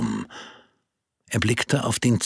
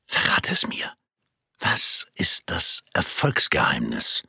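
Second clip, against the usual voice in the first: conspiratorial whisper; 7 semitones lower than usual. Acted. Asking a secret confidentially.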